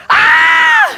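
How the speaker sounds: sudden high shriek, loud; startled scream, hammed up; a mock shocked mother's scream. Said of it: "I heard my mother go".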